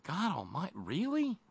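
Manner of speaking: high-pitched, disbelieving question; incredulous reaction. Retold or imitated. Reacting to a story just seen, incredulous. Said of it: "and it's like".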